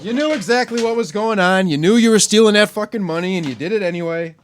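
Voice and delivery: indignant accusation at a crook; accusing rant. Real, not acted. Ranting at Brett Favre.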